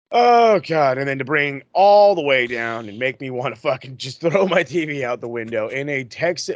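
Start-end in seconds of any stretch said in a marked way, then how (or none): 0.1-1.0 loud pained oh God; exasperated groan. Real, not acted. Fan groans at a terrible match, loud exasperation.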